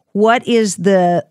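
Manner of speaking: ordinary talk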